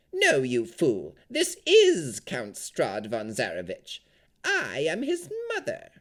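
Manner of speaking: loud, slow scolding crone; angry hag. A narrator's reading. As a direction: snap at the fool like a hag.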